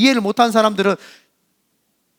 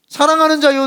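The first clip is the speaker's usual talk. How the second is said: a much higher, louder mock-sweet opening; mocking scripture quote; 7 semitones higher, 5 dB louder than usual. Shown as a demonstration. Pastor mocking a twisted verse, high and loud. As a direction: parody the verse with a sugary lift. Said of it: "주님이 그렇게 이야기합니까?".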